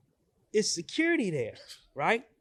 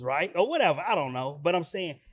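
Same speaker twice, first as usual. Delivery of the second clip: ordinary talk, nothing marked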